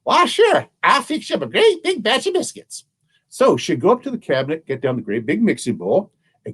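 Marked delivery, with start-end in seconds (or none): 0.0-2.8 put-on much higher old granny voice; sweet, high granny answering her family; a cheerful old granny agreeing to bake; little old granny. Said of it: "little old woman say".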